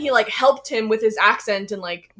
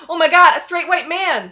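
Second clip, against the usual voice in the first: mock gasp; 6 semitones higher, 9 dB louder than usual.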